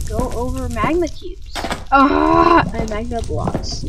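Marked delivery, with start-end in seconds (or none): 1.9-2.6 loud high startled yell; startled scream. Real, not acted. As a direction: yelp in alarm. Falling toward magma.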